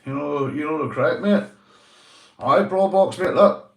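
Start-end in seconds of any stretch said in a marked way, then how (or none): 2.4-3.7 calling out. Real, not acted. Calling out to viewer Brawl Box.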